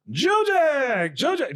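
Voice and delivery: very high mock call for a missing performer; imitated calling out. Announcer trying to get Joe Jack on stage, imitated call. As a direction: call someone out from backstage. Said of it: "trying to get him out on stage".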